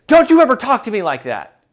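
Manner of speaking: sharp, loud and fast comeback; loud fast retort showing the wrong way; angry retort. Shown as a demonstration. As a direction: snap back as if yelled at. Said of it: "somebody is yelling at you and you say".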